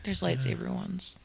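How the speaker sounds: whisper. Real, not acted. Whispered aside.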